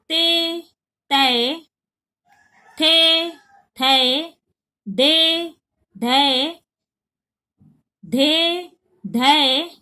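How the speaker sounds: paired syllables, े short and ै open, each clear; contrasting vowel drill. Shown as a demonstration. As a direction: make the difference between े and ै plain. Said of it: "एकदम साफ फर्क दिखाई दे". (े और ै का फर्क साफ़ बोलना)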